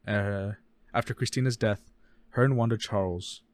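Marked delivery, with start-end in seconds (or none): none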